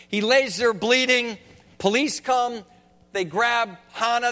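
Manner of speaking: ordinary talk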